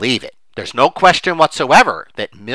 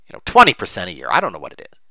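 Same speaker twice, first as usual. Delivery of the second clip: usual speaking voice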